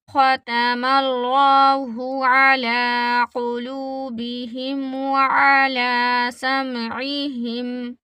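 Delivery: slow tilawat; measured Quranic chant; Quran recitation. Shown as a demonstration. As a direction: chant the verse, drawing out the vowels. Recites the verse slowly.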